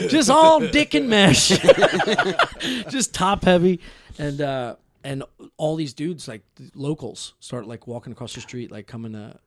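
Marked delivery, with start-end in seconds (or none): 0.1-3.8 slow, loud, higher laughing words. Real, not acted. Joking about his body, loud slow laughter.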